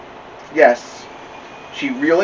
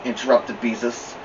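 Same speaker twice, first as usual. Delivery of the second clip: plain reading voice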